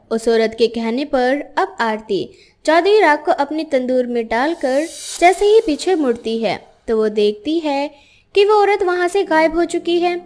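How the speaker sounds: usual speaking voice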